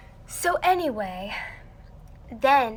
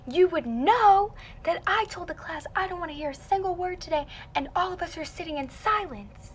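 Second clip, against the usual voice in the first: mock reprimand in a higher voice; high-pitched retold telling-off; imitated scolding teacher; 7 semitones higher than usual. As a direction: lecture like an annoyed teacher. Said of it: "she's screaming at me".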